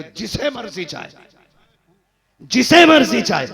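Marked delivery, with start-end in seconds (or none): 2.4-3.5 booming repeat. Acted. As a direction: ring out the repeat much louder and higher. God's decree repeated for force.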